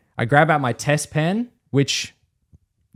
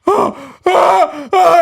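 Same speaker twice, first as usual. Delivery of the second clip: high, loud panicked cries; short bursts of mimicked screaming; freaking-out screams; 7 dB louder, much slower than usual. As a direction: shriek in wordless alarm. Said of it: "he's, like, full freaking out".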